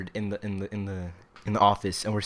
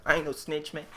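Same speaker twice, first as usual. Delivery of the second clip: a hint of cartoon kid Riley, pitched up; lightly voiced Boondocks kid insisting he's no snitch; higher mock-tough kid voice; 7 semitones higher than usual. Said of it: "like Riley, you know, from the Boondocks".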